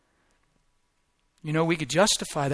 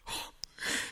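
soft gasp; 15 dB quieter than usual. Real, not acted. Overwhelmed by the word.

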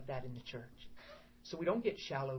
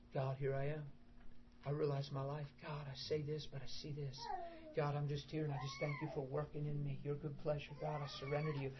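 softer, intimate surrender prayer; quiet prayer; 8 dB quieter than usual. Acted. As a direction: pray it alone behind closed doors. Voices a private prayer.

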